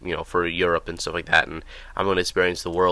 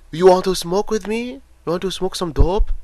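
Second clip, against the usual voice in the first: put-on high, louder Japanese-accented offer; a weird guy in a store, in a Japanese accent; imitating Japanese accent; 13 semitones higher, 4 dB louder than usual. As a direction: mimic a Japanese stranger's accent, pitched high. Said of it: "this accent".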